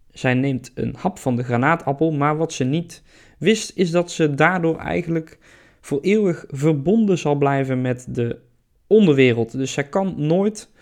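ordinary talk

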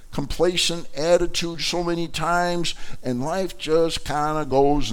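ordinary talk, nothing marked

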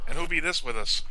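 a hint of a stage Irish lilt; lightly voiced Irish host introducing the guest; mock Irish. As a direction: ask it like a pub-keeper in Irish. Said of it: "your Irish accent sucks".

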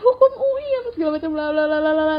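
high, fast, excited rattling; mock giddy chatter. Friends rattling off their majors, high and fast. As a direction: rattle off the results in a mock gush.

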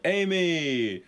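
high, drawn-out name call. Acted. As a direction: call out to the intern in mock greeting. Hosts greet the intern by name.

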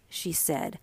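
usual speaking voice

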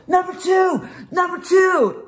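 high-pitched quarterback call; yelled audible. Retold or imitated. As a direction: yell the play change down the line. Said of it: "I'd yell out".

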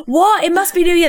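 a band member caught off guard; confused band. Retold or imitated. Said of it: "The band are like".

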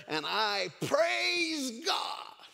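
very slow build to a high holler; high, drawn-out cry of praise; praying out loud. Acted. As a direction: stretch it up into a porch shout of praise. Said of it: "out loud like she normally did".

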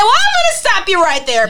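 loud, high-pitched cut-in; emphatic interruption. Retold or imitated. Stopping a date mid-rant, loud high objection. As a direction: jump in high and loud to halt them.

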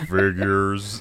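low, resigned mock gloom; Eeyore. Teasing him for not standing up, resigned. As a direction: give up on it in a low voice. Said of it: "he just Eeyores himself completely".

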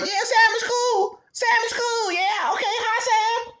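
very high, slow, strained sweetness; jealous girlfriend greeting Sam through fake sweetness; fake friendly girlfriend. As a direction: squeak out a forced hello.

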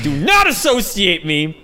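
slow, high, emphatic mock outrage; indignant denial. Telling a stranger not to lump them in, indignant. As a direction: push each word out in comic protest.

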